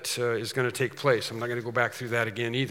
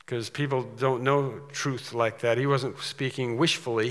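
usual speaking voice

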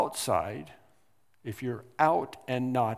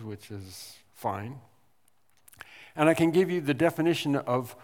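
ordinary delivery

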